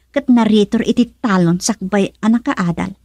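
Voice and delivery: usual speaking voice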